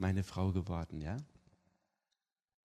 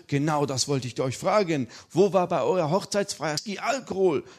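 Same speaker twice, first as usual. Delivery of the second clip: higher and louder; eager father; 6 semitones higher, 6 dB louder than usual. Retold or imitated. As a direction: pounce on the question. Father questioning.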